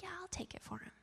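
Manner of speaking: small, high, very soft voice; meek volunteering. Retold or imitated. Girl offering to take his punishment, meek and hushed. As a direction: offer it quietly, high and tiny.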